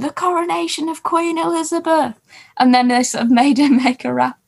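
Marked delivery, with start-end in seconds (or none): 0.0-2.1 mild mock yell, held high; mock audience scream. Audience member yelling out a suggestion, mock scream. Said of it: "one person in the audience just screamed".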